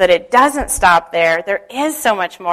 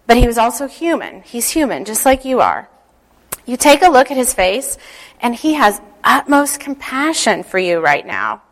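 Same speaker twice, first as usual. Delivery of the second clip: guided meditation. Acted. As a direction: ease them into calm, picturing. Leads a visualization prayer.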